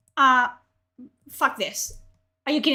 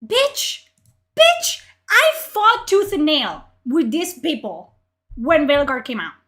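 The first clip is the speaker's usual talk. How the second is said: indignant rant; 2 semitones higher, 7 dB louder than usual. Real, not acted. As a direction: protest hotly, stretching the words. Angry rant about past arguments.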